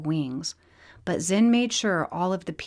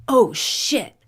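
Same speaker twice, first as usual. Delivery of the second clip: higher, louder startled curse; alarmed outburst; 6 semitones higher, 8 dB louder than usual. Acted. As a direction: blurt it out in alarm. Wayne panics at disobedience.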